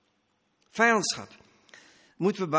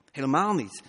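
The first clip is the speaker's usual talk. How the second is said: ordinary delivery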